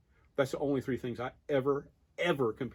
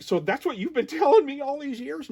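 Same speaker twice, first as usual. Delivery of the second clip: mock indignant; 13 semitones higher, 5 dB louder than usual. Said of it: "And he was like".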